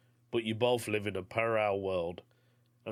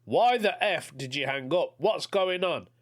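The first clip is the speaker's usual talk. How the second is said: frustrated caller; 6 semitones higher, 4 dB louder than usual. Retold or imitated. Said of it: "this time frustrated that I hang up".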